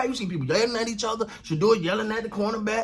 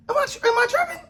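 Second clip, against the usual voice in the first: disbelieving, much higher question; incredulous. Real, not acted. Host asking viewers in disbelief, high incredulous voice. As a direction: ask if you're crazy in disbelief.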